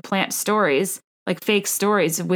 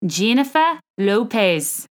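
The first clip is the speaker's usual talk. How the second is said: slow, practised Australian accent; 3 semitones higher, slower than usual. Shown as a demonstration. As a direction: try out the name in an amateur Australian accent. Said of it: "practicing my Australian accent".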